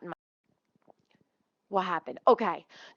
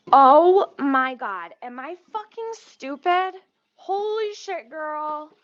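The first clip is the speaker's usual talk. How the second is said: sudden loud panicked realization, pitch jumping high; shocked at yourself, blurting it out loud and high; alarmed outburst; 7 semitones higher, 9 dB louder, slower than usual. Real, not acted. Streamer spotting the stove left on, loud alarmed outburst. Noticing the wrong burner on.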